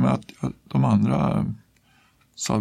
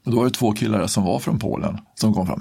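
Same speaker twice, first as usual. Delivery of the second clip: ordinary talk, nothing marked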